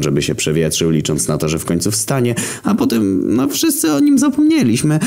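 wysoki, bełkotliwy głos dziewczyny; drunk girl. A narrator's reading. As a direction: zagraj pijaną studentkę, wysoko. Girl tells story. (pijana dziewczyna zagaduje Zarazę, wysoki głos)

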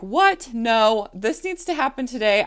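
high, loud outraged protest; shocked patient refusing to redo the test; indignant disbelief. Retold or imitated. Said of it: "I looked at him like".

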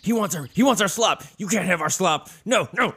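high, squeaky guarding voices; greedy critters. Acted. Badger and chipmunk guarding their slop from Mendel, high and squeaky. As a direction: clutch the bowl and squeak. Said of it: "they're like".

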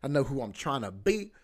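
ordinary talk, nothing marked

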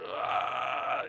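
loud, imitated anguished roar; guttural cry of pain. Podcaster acting out the father's despair, guttural cry of pain. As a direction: let out a guttural pained yell. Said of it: "this cry of pain".

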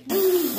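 quick surprised intake of breath; sharp gasp. Real, not acted. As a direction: gasp as if struck by an idea. Sudden idea mid-list.

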